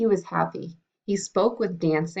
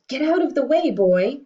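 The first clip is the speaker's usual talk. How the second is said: loud, higher commanding shout; king's order; 8 semitones higher, 7 dB louder than usual. Acted. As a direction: order the boy out of the way. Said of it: "ordered the king".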